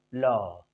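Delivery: "लॉ" low and soft, letting air out; low, held "लॉ"; air released. Shown as a demonstration. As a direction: draw out the vowel of "लॉ" on escaping air. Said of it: "air निकलता है".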